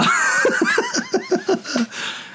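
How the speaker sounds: high giggling burst; high laugh. Real, not acted. After his answer to the caller, a high giggling laugh.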